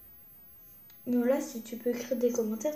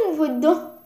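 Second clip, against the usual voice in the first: excited; 2 semitones higher, 8 dB louder, slower than usual. Real, not acted. Girl thrilled peeking into the Eiffel Tower globe. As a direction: gush over what you see inside.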